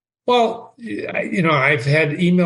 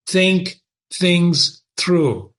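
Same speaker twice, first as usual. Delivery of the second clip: slow, deliberate; 3 semitones higher, much slower than usual. Shown as a demonstration.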